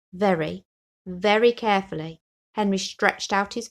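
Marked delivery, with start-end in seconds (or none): none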